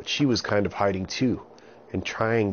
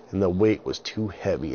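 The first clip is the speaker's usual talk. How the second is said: usual speaking voice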